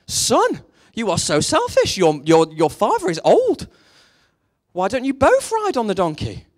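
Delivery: loud, high-pitched acted outrage; mock scolding. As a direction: tell off a selfish son, hammed up. Third passers-by scold son in fable.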